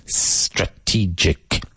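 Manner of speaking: Strategic said slowly, starting straight on the S; no added E. Shown as a demonstration. Teacher warning Spanish speakers off estrategic. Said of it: "careful not to say estrategic".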